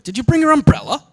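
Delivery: a lecturer acting out an English speaker asking about an umbrella, in a falling tune; British falling question. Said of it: "I live in England".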